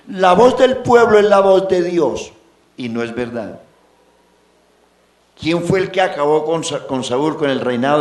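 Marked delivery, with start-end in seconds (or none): 0.1-2.3 lightly mimicked voice of the people; street saying. Preacher quoting a common street adage, mock proclamation. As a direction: proclaim it higher and louder, like a popular slogan. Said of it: "del pueblo callejero que dice".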